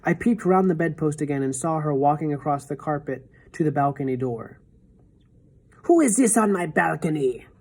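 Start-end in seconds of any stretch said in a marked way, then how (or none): none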